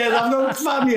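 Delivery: high imitated yelling, clinging on; mock panicked shout. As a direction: cry out like a man hanging off a ladder. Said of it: "He's shouting".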